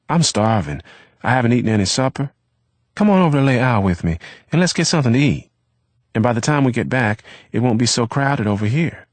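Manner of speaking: put-on fast and bright, eager to feed the hungry boy; eager rush. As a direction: sell the idea in one breathless burst. Eric eagerly proposes a meal.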